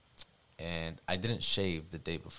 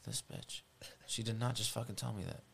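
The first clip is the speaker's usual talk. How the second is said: hushed disbelief; 3 semitones higher, 8 dB quieter than usual. Retold or imitated. After his boss threatens him, a hushed stunned whisper. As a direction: whisper it under your breath, stunned.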